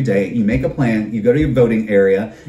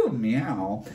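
soft meow; 3 semitones lower, 10 dB quieter than usual. Pretend. Playful cat noise after laughing.

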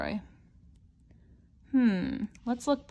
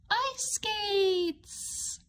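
much higher, a happy exclaim; light up at the gift; delighted; 9 semitones higher than usual. Acted. Revealing the ice skates to the child, delighted. Opening the green box.

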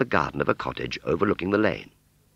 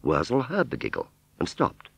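usual speaking voice

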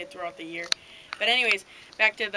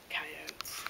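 whisper; 4 semitones lower, 11 dB quieter than usual. Real, not acted. Dark morning, coyotes out.

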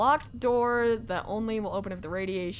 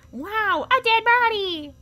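mock excitement; 11 semitones higher, 5 dB louder, slower than usual.

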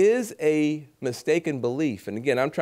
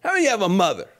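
rallying question; 6 semitones higher, much faster than usual. Retold or imitated. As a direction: rouse the crowd with the question. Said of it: "You gotta find common ground".

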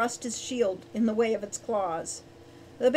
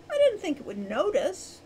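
high-pitched self-defence; protesting; 7 semitones higher than usual. A narrator's reading. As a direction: plead innocence in a higher voice. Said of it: "Wren protested".

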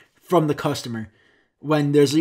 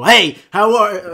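loud, drawn-out high holler; slow mock yell, cut short; aggressive greeting; 6 semitones higher, 10 dB louder, much slower than usual. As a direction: overdo a hostile hello. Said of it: "You don't have to fucking be so".

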